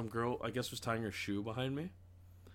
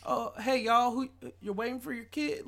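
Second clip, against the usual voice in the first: high, sugary, wary teacher voice; sweet but suspicious daycare teacher; 12 semitones higher than usual. Retold or imitated. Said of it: "They go".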